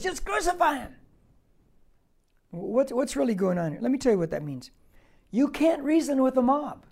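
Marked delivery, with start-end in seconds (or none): 0.0-0.9 high, strained crowd cry; pitched-up, insistent yell; mob outburst. Acted. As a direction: cut in like an unreasoning mob. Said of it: "think of what's going on".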